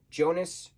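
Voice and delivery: "Jonas" said with a J as in jam; "Jonas" starting with an English J sound. Shown as a demonstration. Comparing the German pronunciations of the name, J sound. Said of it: "J, sh, and what was the other one?".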